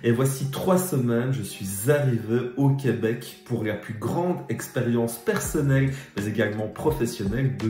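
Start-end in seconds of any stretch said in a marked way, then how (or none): none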